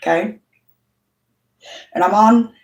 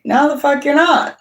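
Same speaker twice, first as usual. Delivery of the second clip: retold defiant refusal; 7 semitones higher than usual. Refusing dentist for daughter.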